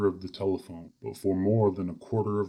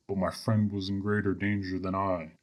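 plain reading voice

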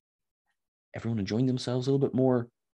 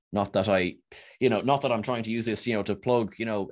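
usual speaking voice

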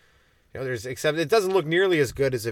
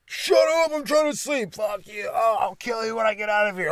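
mock angry cellmate; 9 semitones higher than usual. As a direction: bark it like a fed-up drunk cellmate. Drunk tank cellmate yelling.

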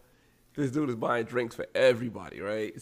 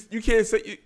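ordinary talk

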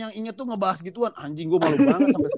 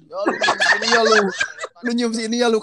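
laughing tease; 4 semitones higher, 4 dB louder than usual. Retold or imitated. Friends mocking him retold.

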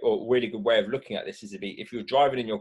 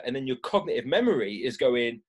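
ordinary talk

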